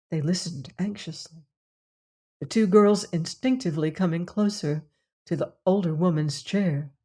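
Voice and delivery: usual speaking voice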